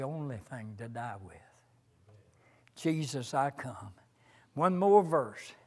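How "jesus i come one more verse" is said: normal talking voice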